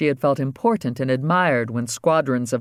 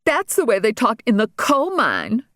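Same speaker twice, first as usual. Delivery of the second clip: smug; 9 semitones higher than usual. Acted. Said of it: "Regina smugly said to Andy".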